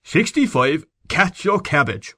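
put-on high, slow barked order in a thick country Irish accent; thick provincial command. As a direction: bark it like a country sergeant, Irish brogue thick. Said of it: "He assumed a thick provincial accent, and said in a tone of command".

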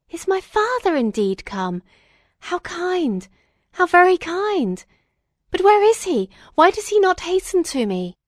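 high-pitched joy building to impatient eagerness; overjoyed, eager. A narrator's reading. Son learning his father has come, overjoyed. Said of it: "relaxed from anguish to pleasure".

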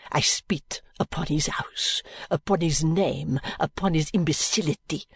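contemptuous French maid. Acted. Maid's contempt.

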